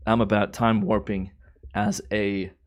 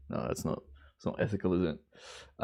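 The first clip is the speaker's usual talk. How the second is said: hushed aside; 3 semitones lower, 9 dB quieter than usual. Real, not acted. Backing off a joke about ripping off.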